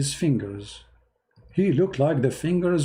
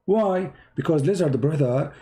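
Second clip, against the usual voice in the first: usual speaking voice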